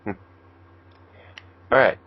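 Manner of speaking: ordinary talk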